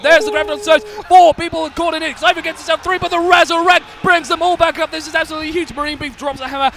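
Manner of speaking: high, loud esports call at full tilt; loud, high caster hype over a huge team fight; hyped play-by-play. Acted. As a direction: sell the teamfight like it's the final.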